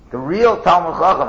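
REAL punched high and loud; stress on REAL. Real, not acted. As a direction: hit the word real hard. Emphatic preaching, real stressed.

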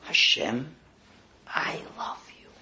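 whispered, full of emotion; heartfelt whisper. Shown as a demonstration. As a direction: breathe it out like a confession of love. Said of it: "with the most emotional voice you could drum up".

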